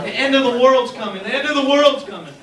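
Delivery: mock panic. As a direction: wail it like doomsday's here. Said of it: "They're like".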